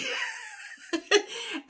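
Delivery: high burst of real laughter; big laugh. Real, not acted. Freed woman laughing at her own dent, big high laugh. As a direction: laugh out hard in relief. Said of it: "laughed, like, really hard".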